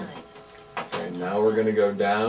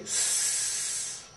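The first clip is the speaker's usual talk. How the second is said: snake hiss; 12 dB quieter than usual. Shown as a demonstration. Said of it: "if you wanna be a snake, say".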